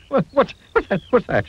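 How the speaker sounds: high, stuttering alarm; frightened shriek. A narrator's reading. Mister Jelly hears a twig snap, frightened shriek. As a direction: start at a squeal, dropping as it repeats. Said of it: "he shrieked".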